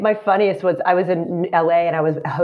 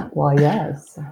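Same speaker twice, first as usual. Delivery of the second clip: put-on British accent; 4 semitones lower than usual. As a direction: answer in a mock British accent, dropping low on the last word.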